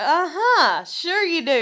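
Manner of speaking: high, loud mock agreement; smart-ass. Woman retelling her comeback to a man, smart-ass. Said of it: "being smart ass".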